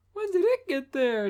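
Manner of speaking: put-on high gasping girly voice; high surprised girl. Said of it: "Dawn gasped".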